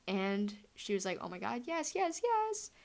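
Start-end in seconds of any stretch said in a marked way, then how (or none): 1.2-2.7 rising to much higher; mock-overjoyed acceptance of a proposal; overjoyed yes. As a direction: gush yes over and over.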